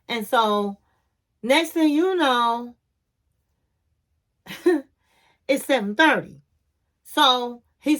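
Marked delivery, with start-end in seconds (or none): 1.4-2.8 "next thing you know" drawn out slowly for suspense; suspenseful slowdown. Acted. Slowed, building suspense before laugh.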